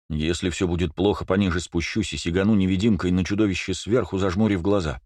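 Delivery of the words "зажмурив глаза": plain reading voice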